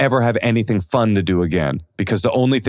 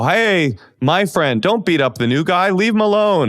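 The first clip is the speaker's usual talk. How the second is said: high-pitched retold protest; raised voice, imitated intervention; mock calling out; 8 semitones higher than usual. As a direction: call off a friend, acted out in retelling. Stepping in to stop beating.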